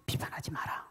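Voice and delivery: hushed, voiceless divine command; whispered command. Acted. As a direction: whisper the warning like God speaking. God's command voiced softly. (속삭이는 하나님의 명령)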